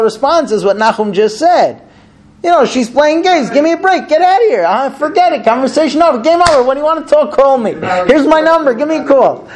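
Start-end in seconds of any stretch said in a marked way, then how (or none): 2.4-9.5 high-pitched, fired-off dismissive retorts; exasperated man ending the conversation; impatient brush-off. Acted. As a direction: cut them off like you're done arguing. Acts out dismissive husband ending talk.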